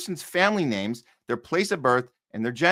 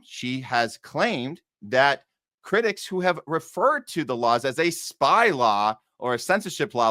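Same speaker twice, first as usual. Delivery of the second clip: ordinary talk, nothing marked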